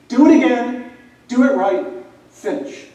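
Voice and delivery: loud, high retold shout at a teammate; calling him out. Senior calls out a quitting teammate, loud shout. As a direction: bark it like a fired-up senior. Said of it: "he calls me out".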